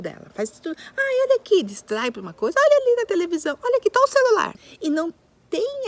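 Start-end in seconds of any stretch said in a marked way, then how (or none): 1.0-1.6 distracting adult. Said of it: "o adulto faz de tudo pra". 2.6-4.5 distracting adult. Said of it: "o adulto faz de tudo pra".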